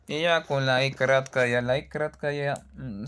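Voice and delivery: ordinary talk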